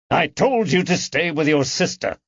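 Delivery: a touch of irritated snap; snapped. A narrator's reading. Grandfather scolding granddaughter, mild irritated snap. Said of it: "Howard snapped".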